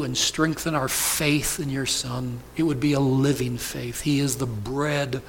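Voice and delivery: pastor praying to close the sermon; pastoral prayer. Acted. Pastor leads closing prayer.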